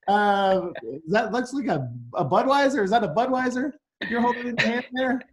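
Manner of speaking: high, mock-surprised lawyer gotcha on Budweiser; mock incredulous cross-examination. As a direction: feign innocent surprise, then spring the trap. Mock cross-exam spotting the beer.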